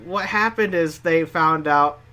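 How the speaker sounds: ordinary delivery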